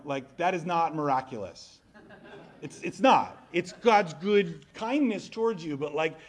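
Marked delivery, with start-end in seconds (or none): none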